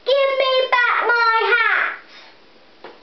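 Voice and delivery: high, loud, slow angry demand; a child playing a cross hat seller; cross shout. A narrator's reading. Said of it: "He shouted at the monkeys".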